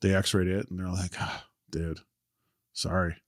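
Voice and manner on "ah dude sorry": soft sorry doctor. Retold or imitated. Doctor delivering bad news.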